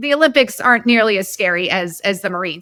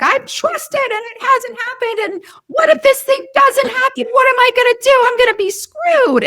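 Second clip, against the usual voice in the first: put-on much higher, frantic little-kid voice; panicked inner child; 14 semitones higher than usual. Host voicing her frightened inner child, high and panicky. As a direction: let the scared inner child whine out in panic. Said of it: "that's my inner child who's screaming".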